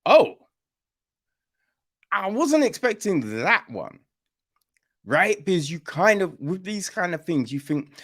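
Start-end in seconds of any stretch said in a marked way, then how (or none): 0.1-4.0 mock surprise. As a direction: blurt it like the twist just hit you. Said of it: "you're a bit like".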